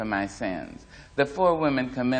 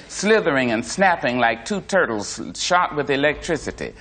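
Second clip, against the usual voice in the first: ordinary delivery, nothing marked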